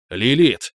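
loud summoning call. Acted. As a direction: call her name out loud. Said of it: "Позвал я".

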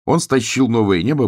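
ordinary delivery, nothing marked

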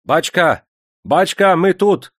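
loud high call through the door; shouting. A narrator's reading. Bashkir envoy calling through the door, loud high shout. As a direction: yell to be heard from outside. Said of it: "закричал".

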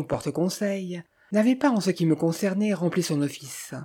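ordinary delivery

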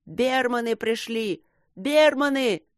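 high, drawn-out cheering call; joyful cries. A narrator's reading. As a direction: cry out the news in joy across the fort. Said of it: "над фортом неслись радостные крики".

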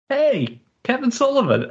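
high-pitched, eager hail; retold calling out. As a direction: call across the bar to get his attention. Hailing a man at the bar.

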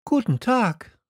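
put-on high-pitched young greeting; light, high hello; Little Prince. A narrator's reading. Prince greets the switchman, in a high voice. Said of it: "sagte der kleine Prinz".

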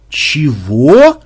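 loud, rising, dumbfounded question; baffled by the demand; stunned what. A narrator's reading. Macmed asking the boatman back, loud and stunned. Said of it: "ошарашенно переспросил Макмед".

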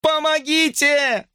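high, slow drawn-out scream; desperate scream; scream for help. A narrator's reading. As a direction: cry for help. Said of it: "закричала Брук".

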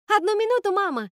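high, loud call down to someone; calling back. A narrator's reading. Princess answers mother from the tower, calling back.

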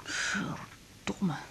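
muttered curse under breath; whispered curse. Acted. Woman groggy after the call, whispered curse. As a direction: swear softly to yourself. Waking up cursing.

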